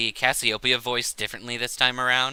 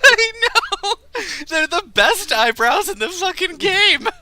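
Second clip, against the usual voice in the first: high, loud laughing yell; laughing shout; 8 dB louder than usual. Real, not acted. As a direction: crack up while yelling. Laughing about eyebrows.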